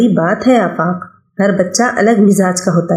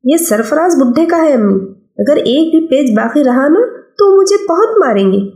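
put-on higher boyish voice, brushing mother off; a busy schoolboy refusing food, a bit higher; young boy; 7 semitones higher than usual. Son refusing his mother's call to lunch, boyish and higher.

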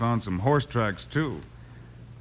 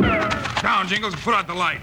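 sharp, high urgent warning; urgent shout; 8 semitones higher than usual. Acted. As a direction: bark the order as shots come in. Gunfire, urgent warning in the dark.

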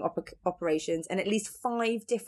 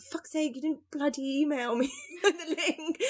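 mock exasperation; 7 semitones higher than usual. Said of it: "I was like".

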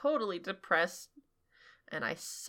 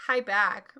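ordinary talk, nothing marked

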